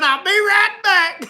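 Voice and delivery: high, slow mock Ralph; drawn-out hammed-up Ralph calling out the window; imitating Ralph. Ralph's deadpan line retold.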